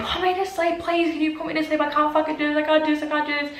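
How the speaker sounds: high, panicked begging without much volume; retold screamed pleading. Paralyzed patient begs staff to sedate her, mock screamed plea. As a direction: re-enact desperate begging, pitch held high. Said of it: "I was screaming".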